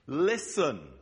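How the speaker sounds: LISTEN hit loud, then falling; like an exclamation mark. Acted. Preacher flagging what was just said, LISTEN emphatic and loud. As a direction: punch LISTEN to demand attention. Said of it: "it's like this exclamation mark".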